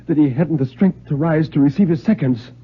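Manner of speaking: grave, measured storyteller's voice; story narration. A narrator's reading. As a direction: read it like a tale that's heading for disaster.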